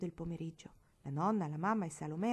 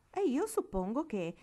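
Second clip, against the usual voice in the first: high, loud, slow offended cat; indignant cat voice; 5 semitones higher, 5 dB louder, slower than usual. A narrator's reading. Lucifer the cat complaining about his ribbon, indignant. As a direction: grumble like a dignified cat in a ribbon. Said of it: "sembrò dire Lucifero".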